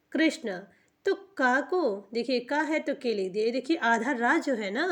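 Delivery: normal talking voice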